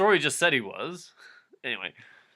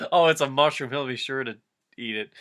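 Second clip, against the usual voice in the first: ordinary delivery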